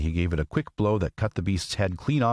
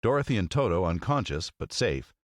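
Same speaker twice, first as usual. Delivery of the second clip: radio host intro; 5 semitones higher than usual. Acted. Host opening the podcast, showman announcer voice. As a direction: welcome the listeners like a show host.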